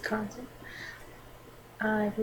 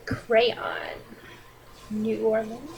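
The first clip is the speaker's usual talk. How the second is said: usual speaking voice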